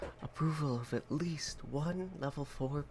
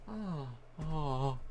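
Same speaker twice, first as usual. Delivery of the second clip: normal talking voice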